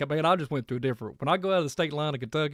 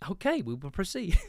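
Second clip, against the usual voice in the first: mock AI assistant voice, pitched up; 5 semitones higher than usual. The AI assistant replying, mock robotic voice. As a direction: imitate the phone bot. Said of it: "I'm like".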